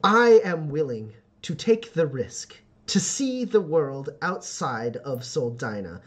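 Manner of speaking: slow, halting declaration of resolve; determined youth picking each word slowly; earnest young homesteader. Acted. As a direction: steel yourself and state it deliberately. Voices Joe accepting the challenge.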